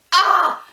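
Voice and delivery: a sudden high, loud "ah" of heartbreak; loud, high wail of distress; anguished cry. Real, not acted. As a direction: cry out as if it hurts. Overwhelmed by the sad scene.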